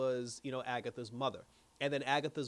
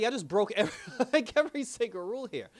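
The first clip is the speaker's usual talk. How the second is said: laughing; 5 semitones higher, 5 dB louder, faster than usual. Real, not acted. Laughing while retelling rule-breaking.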